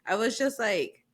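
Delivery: ordinary talk, nothing marked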